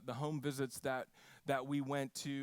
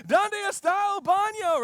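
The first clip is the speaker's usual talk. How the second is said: high, loud panicked shout; hammed-up panicked tourist shouting, high and loud; mock frantic tourist; 13 dB louder than usual. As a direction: shout it again running around in panic. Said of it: "being like".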